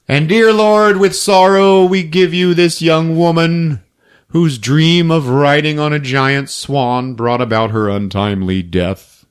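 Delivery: solemn mock-preacher intonation; imitated graveside prayer, high and falling gradually; funeral prayer. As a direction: intone it slowly like a eulogy. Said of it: "We're at the funeral".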